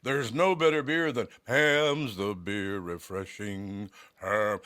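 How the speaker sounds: slow, booming beer commercial announcer. Shown as a demonstration. Former voiceover man showing his beer commercial voice, slow and booming. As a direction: sell it like an old beer ad. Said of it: "You got a good beer voice".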